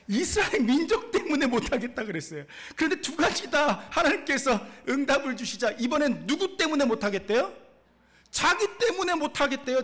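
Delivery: loud, high-pitched rhetorical questions and answers; full-force preaching. Real, not acted. Preacher quizzing the congregation on Moses's excuses, forceful and loud. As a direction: drive each point home forcefully.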